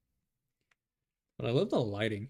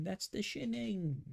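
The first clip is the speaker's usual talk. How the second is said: high, drawn-out British "Shinning" for "Shining"; mock British accent; 9 semitones higher, slower than usual. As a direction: stretch a squeaky British correction, short-i "Shinning". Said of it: "British people be like".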